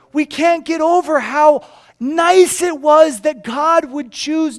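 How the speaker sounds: high, loud, slow proclamation; full-force pulpit voice, stretched emphasis; impassioned preaching. Real, not acted. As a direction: drive it home to the whole congregation.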